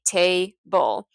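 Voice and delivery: TABLE as TAY, pause, BUL; table split in two. Shown as a demonstration. Teacher showing where table divides, TABLE said as tay - bul. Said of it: "break table up into two syllables".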